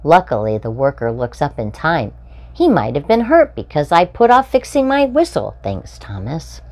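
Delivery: bright, lively storybook read-aloud; animated children's story reading. A narrator's reading. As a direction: read it like a picture book for little ones. Reading picture book to children.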